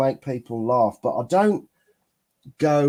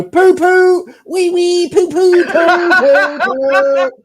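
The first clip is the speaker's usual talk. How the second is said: high, loud silly chant of poo poo; silly childish voice; 12 semitones higher, 6 dB louder, slower than usual. Retold or imitated. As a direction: squeal it like a giggling kid. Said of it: "you would just come in out the blue and go".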